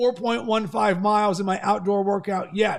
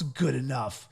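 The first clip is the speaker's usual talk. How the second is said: much lower and softer, set apart; air-quoted; 7 semitones lower, 7 dB quieter than usual. Retold or imitated. Runner quoting his self-talk back, air-quoted. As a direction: drop the phrase in dismissive quote marks. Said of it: "Air quotes".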